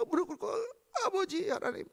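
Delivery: high, tearful, quiet cry to the Father; a broken-hearted plea, voice rising high; tearful cry to God. Retold or imitated. Hannah kneeling before God, tearful high plea.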